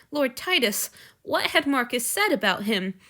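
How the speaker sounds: plain reading voice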